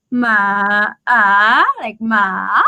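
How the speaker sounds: ma dipping low then swooping up; exaggerated rising ma. Shown as a demonstration. Teacher exaggerating the rising tone, low then up. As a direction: overdo the rise on ma. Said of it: "when I exaggerate".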